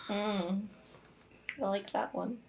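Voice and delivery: ordinary talk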